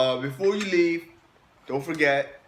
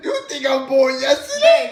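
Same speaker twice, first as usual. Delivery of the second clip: loud laughing protest; yelled disbelief, high; shouting; 9 semitones higher, 8 dB louder than usual. Real, not acted. Refusing the spoon.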